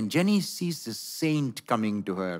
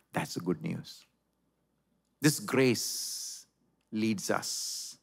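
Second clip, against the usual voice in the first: ordinary talk, nothing marked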